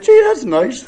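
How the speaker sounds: high, cracking mock-choked voice; voice breaking up. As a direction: let the voice break upward, played for laughs. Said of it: "And my voice broke up".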